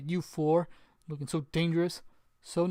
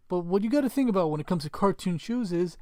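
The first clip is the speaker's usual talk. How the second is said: ordinary talk, nothing marked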